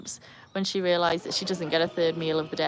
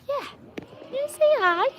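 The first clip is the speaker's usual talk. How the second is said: squeaky pet talk; faster than usual. Real, not acted. Repeats it to the animal, higher.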